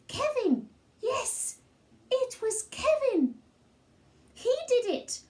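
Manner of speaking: put-on high, eager child voice insisting; a small boy talking fast to dodge the blame; little boy Sid protesting. Boy blaming Kevin to Mum, high eager child voice. Sid pleads his innocence.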